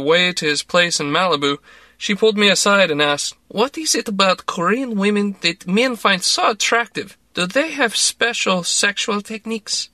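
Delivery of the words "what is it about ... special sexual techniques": put-on Russian-accented, higher, curious and blunt; a nosy Russian lady fishing for gossip; prying Russian woman. A narrator's reading. Larissa asks the narrator about Korean women, in a higher, curious Russian accent.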